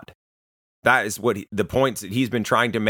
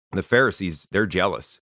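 normal talking voice

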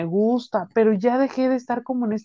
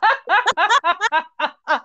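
laughing hard at the story; high burst of laughter; 9 semitones higher than usual. Real, not acted. Se ríe de la historia. (carcajada aguda)

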